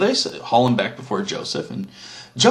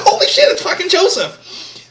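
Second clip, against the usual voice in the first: much higher, louder gasp of disbelief; shocked exclamation; 7 dB louder than usual. Retold or imitated. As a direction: shout it as the brothers recognize him. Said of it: "They're like".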